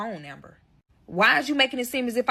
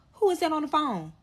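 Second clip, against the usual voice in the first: higher, prying mock questions; lightly voiced nosy friend pestering; mimicking a nosy friend; 6 semitones higher than usual. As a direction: friend peeking over her shoulder, mock nosy voice. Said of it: "talking about some".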